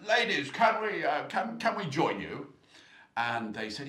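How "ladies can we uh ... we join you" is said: much higher, louder, smarmy ladies' man; hammed-up posh charm; mock charming greeting. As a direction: trainer chatting up three ladies in the pub. Trainer Brooks greets the ladies.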